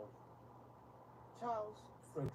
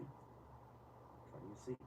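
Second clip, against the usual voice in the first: slow, hushed whisper; 12 dB quieter, much slower than usual. Real, not acted. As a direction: breathe the question out slowly, almost secret. Repeats question, drops to whisper.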